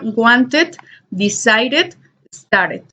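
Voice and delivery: WANTED, DECIDED, STARTED with "ed" as its own syllable; added final syllable. Shown as a demonstration. Teacher reviews the last ending, extra syllable on wanted, decided, started. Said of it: "cuando añadimos una sílaba".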